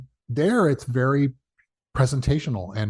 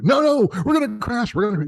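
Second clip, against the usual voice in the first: panicked passenger; 10 semitones higher than usual. Retold or imitated. Nervous passenger panicking at the flight attendant, frantic. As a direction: squeal it like a terrified flyer.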